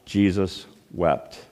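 hushed reverent reading; slow hushed verse. Shown as a demonstration. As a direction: say the two words slowly and let them fall. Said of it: "the shortest verse in the Bible".

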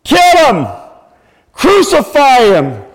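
loud, high, slow shouting of the mob's demand. Retold or imitated. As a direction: bellow it like the angry crowd before Pilate. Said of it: "the crowd that shouted to Pilate".

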